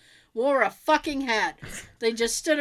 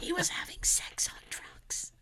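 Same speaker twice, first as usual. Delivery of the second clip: exaggerated scandal whisper; stage whisper; 7 semitones higher, 11 dB quieter than usual. Shown as a demonstration. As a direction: whisper it like juicy gossip. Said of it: "exaggerated stage whisper".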